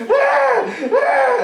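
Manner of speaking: very high mock shriek; kids screaming. Kids shrieking at each other, piercing high. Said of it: "in a circle going".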